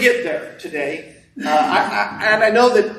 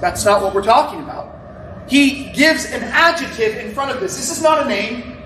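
rising, emphatic sermon voice, higher; preaching; 5 semitones higher than usual. Real, not acted. Pastor preaching to the congregation on Holy Spirit, emphatic. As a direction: preach it with building conviction. Preaching builds through the verse.

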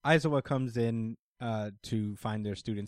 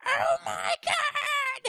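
very high-pitched drawn-out mock scream; stretched falsetto shriek, playfully overacted; hammed-up shriek; 4 dB louder, much slower than usual. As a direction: squeal it out slowly, playing a freaked-out character. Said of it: "Ashido was like".

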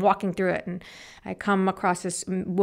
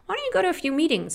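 stranger's quick suggestion; 10 semitones higher, faster than usual. Retold or imitated. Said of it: "And she's like".

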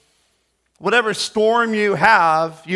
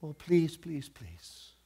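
soft, timid begging; a lightly voiced meek disciple; meek mock plea; 12 dB quieter, slower than usual. Disciples meekly begging Jesus, soft and timid.

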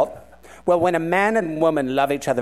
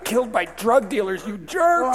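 hammed-up cry at the jerks; mock outraged shout; 8 semitones higher than usual.

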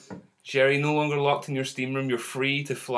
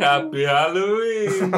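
festive greeting; 6 semitones higher, 7 dB louder, slower than usual. Acted. Festive greeting after the break.

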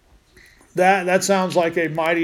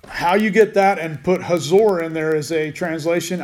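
normal talking voice

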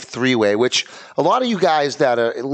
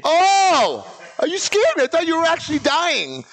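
high, startled relief; surprised. Real, not acted. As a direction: caught off guard by a prank. Friend's fake cramp revealed.

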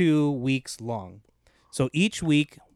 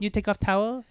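high teasing masseuse voice; brassy gum smacking floozy; 7 semitones higher than usual. Pretend. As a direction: flirt it up, sultry and cheap. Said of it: "mock masseuse flirting".

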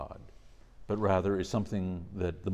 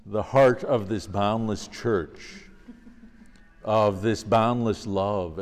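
formal reading; 2 semitones higher, 8 dB louder, slower than usual. Acted. Reads a quoted passage.

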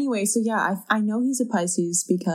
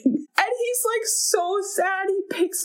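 way higher, drawn-out voice on so sad; pitched-up sad telling; 10 semitones higher than usual. Real, not acted. Friend telling a podcast cohost, high and drawn-out. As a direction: stretch it out, aching at the scene.

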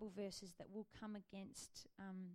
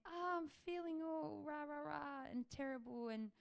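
whining David; 5 semitones higher than usual. Retold or imitated. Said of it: "he'll start off by saying".

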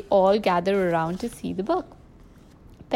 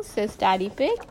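usual speaking voice

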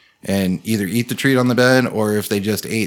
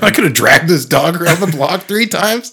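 mock boast; 6 semitones higher, 5 dB louder than usual. Friend's boast retold by S1.

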